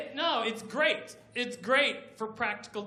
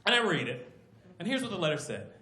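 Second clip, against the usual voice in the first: usual speaking voice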